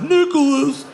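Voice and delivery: high, loud scolding call of the name; scolding drunk. Retold or imitated. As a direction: call him out like an angry grown-up. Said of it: "They were like".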